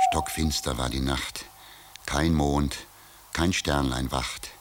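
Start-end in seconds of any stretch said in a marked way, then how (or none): none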